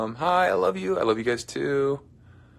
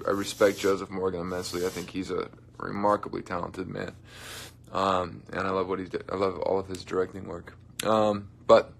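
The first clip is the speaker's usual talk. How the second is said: ordinary delivery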